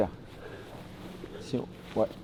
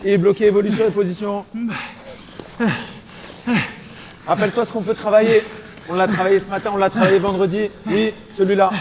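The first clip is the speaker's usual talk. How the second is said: shouting coach; 6 semitones higher than usual. Real, not acted. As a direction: call it out from the corner. Coach calling to fighter mid-round.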